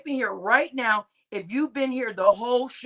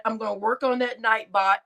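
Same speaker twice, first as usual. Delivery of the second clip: normal talking voice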